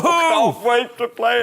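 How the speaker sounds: laughing through mock excitement, high and loud; laughing sarcasm. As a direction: burst out laughing while faking eagerness. Sarcastic joke about Farming Simulator.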